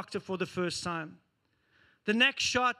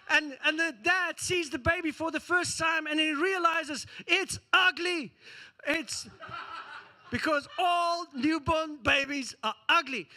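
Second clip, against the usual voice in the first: ordinary talk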